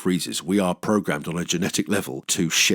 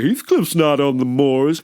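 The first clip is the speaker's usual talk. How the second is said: mock quip; 6 semitones higher, 5 dB louder than usual. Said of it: "They're just wisecracking away".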